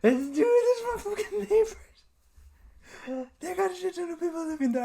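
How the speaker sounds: very high squeal of amusement; laughing incredulity. Real, not acted. Friend rants about his crowded neighbors, laughing and incredulous. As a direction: squeal it while cracking up.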